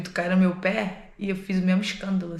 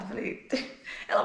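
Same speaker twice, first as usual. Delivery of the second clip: usual speaking voice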